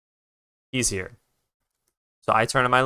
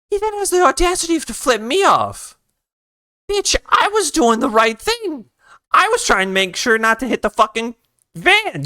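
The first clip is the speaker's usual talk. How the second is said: indignant outrage; 12 semitones higher than usual. Real, not acted. Flipped off by another driver.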